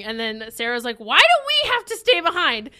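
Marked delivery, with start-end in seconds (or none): 1.0-2.7 higher-pitched retold protest; offended. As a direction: imitate someone miffed at being left out. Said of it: "she's all offended or whatever".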